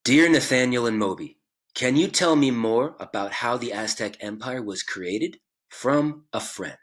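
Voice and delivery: slow, careful reading into the mic; recorded letter read. Shown as a demonstration. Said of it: "record my own voice reading that letter".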